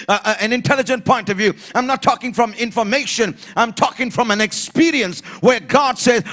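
high, loud, insistent preaching; preaching at full force. Real, not acted. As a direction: hammer out each repeated phrase to the church.

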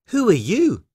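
put-on high, surprised little girl; Lucy. Lucy questions a strange creature.

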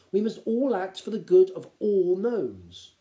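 measured, lightly stern pronouncement. A narrator's reading. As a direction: lay down the principle firmly. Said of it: "said Gerda sternly".

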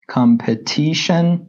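competition said very slowly, syllable by syllable; drawn-out competition for learners to copy; slow model pronunciation. Shown as a demonstration. Teacher models the new word for students, very slow and clear.

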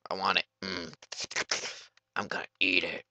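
ordinary talk

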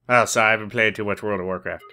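ordinary talk

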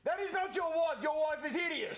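incredulous repeat. Acted. Repeats the insult, much higher.

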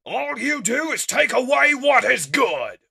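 high, full-force shouting; furious hero's outburst; enraged accusation. Acted. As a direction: yell it at the enemy you are attacking. Hero rages at enemy while attacking.

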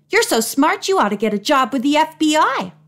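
high, impressed and emphatic; gushing admiration. A narrator's reading. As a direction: pile on the wide-eyed praise. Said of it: "Marjorie gasped admiringly".

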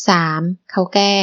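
plain reading voice

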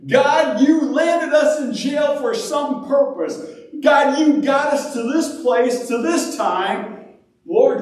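high, impassioned appeal to God; acted fervent pleading; pleading prayer. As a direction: cry out in earnest prayer, pitch held high. Acts out the prisoners' pleading prayer.

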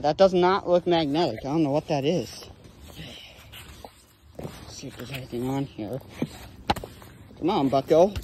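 ordinary delivery

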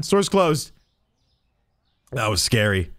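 ordinary delivery

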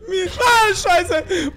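high crying yelp; panicked whimper. Real, not acted. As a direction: cry out in fear. Scared, near tears.

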